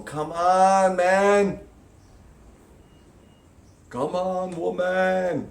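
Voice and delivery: loud, high, impatient coaxing; exasperated come on. Pretend. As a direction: nudge them on like an exasperated coach. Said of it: "up there going".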